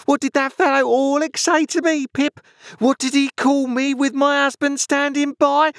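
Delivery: very high hysterical screech; screaming outrage. A narrator's reading. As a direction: screech like an insulted wife demanding witnesses. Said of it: "beginning to scream".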